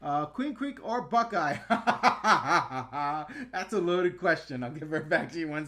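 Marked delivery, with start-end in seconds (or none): 1.6-3.5 loud, real belly laugh; big laugh. Real, not acted. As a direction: burst out laughing at the question. Laughs at a loaded question.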